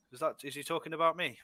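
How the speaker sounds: ordinary talk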